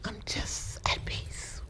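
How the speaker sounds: slow, high whisper of peace; awed whisper. Retold or imitated. In her car, whispering her newfound peace in wonder. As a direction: whisper it slowly, lost in wonder.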